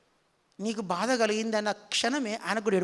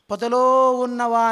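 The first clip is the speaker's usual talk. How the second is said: slow and loud, each word drawn out; emphatic quote; 9 dB louder, much slower than usual. Acted. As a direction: lean hard and slow on "పొదలో ఉన్నవా". Quoting the scripture phrase. (నెమ్మదిగా, బిగ్గరగా నొక్కి చెప్పిన వచనం)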